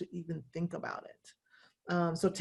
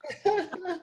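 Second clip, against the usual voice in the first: laugh; 9 semitones higher than usual. Real, not acted. Laughing after the joke.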